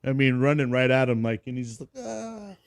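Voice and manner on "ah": soft, high "ah"; small high mock cry of alarm; imitated scared cry. Imitated recruit as instructor charges him.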